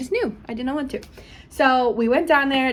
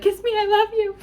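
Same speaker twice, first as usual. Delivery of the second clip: mock high quote; 12 semitones higher than usual. Retold tearful quote, high.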